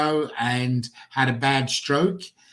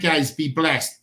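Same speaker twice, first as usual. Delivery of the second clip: usual speaking voice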